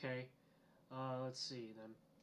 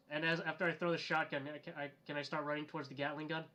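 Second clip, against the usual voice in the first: ordinary talk, nothing marked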